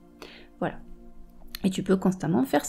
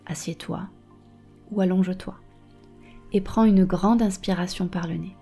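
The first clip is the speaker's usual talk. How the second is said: right in your ear. Acted. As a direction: breathe calm into every phrase. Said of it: "Je vais te guider dans une méditation".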